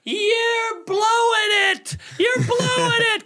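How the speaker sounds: very high, slow, drawn-out jeer; drunk heckler. Retold or imitated. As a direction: jeer at the comic from the front row. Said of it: "She's going".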